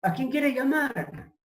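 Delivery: overjoyed doctor offering a call; happy doctor. Retold or imitated. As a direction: beam as you offer the phone. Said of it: "el médico era contentísimo".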